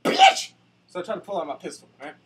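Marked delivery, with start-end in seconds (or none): 0.0-0.5 very high, loud screeching insult; mock outraged shriek. Shot gunman shrieking at his killer, very high. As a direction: shriek it like a betrayed gamer. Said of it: "And I was like".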